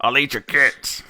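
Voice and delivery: slow, drawn-out troll threat; hammed-up hungry troll voice; bridge troll. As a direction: menace like a comic New York troll. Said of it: "Charlie Bridge Troll is going to starve".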